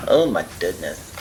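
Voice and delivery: ordinary talk, nothing marked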